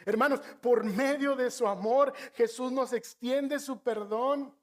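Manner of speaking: preacher urging the brothers to see their sin; fervent preaching. Acted. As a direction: preach it to the congregation with force. Preaching the gospel.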